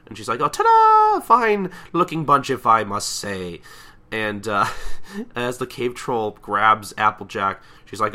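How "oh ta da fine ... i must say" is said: high ta-da, then slow self-satisfied drawl; showy presenting. Retold or imitated. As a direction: unveil it grandly, settling down slowly. Rarity unveils her models.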